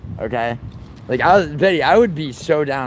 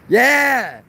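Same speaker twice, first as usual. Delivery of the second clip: hyped-up mock quote; 8 semitones higher, 6 dB louder, slower than usual. Acting out someone's excited suggestion.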